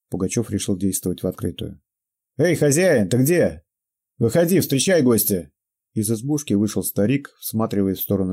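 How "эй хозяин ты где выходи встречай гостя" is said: calling out. Acted. Pugachev calls out to the hut.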